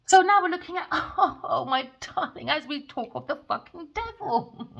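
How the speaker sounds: high and loud, cackling with glee; gleeful laughing. Real, not acted. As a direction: crow over it with a laugh. Gleeful gloating over the card.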